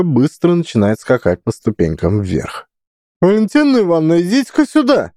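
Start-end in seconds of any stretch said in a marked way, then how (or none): 3.2-5.1 high, fast shrill call; shrill shout. A narrator's reading. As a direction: shriek her name out. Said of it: "визгливо кричит директриса".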